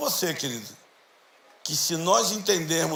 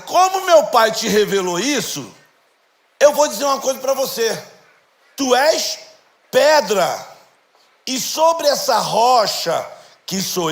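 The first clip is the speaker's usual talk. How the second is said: louder, slow, emphatic sermon delivery; full-force preaching; 2 semitones higher, 7 dB louder than usual. Acted. Pastor proclaiming Jesus' words to the congregation, preaching hard. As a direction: proclaim it to the whole church.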